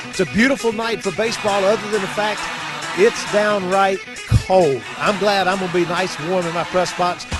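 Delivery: upbeat play-by-play announcer read; sports broadcast intro. Acted. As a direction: open the broadcast like a radio host. Broadcaster opens the game show.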